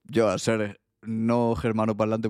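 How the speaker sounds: usual speaking voice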